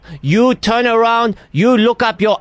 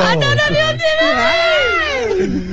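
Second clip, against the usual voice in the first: mock Chinese accent. Host reveals the prank, mimicking the victim in a mock Chinese accent. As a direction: shout it back at him in a mock Chinese accent. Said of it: "your Chinese accent is still atrocious".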